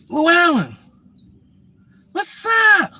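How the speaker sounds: mock her shrill-pitched hello, stretched out; excited woman greeting. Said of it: "She's like".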